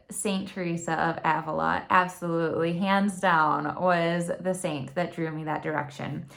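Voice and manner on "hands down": ordinary talk, nothing marked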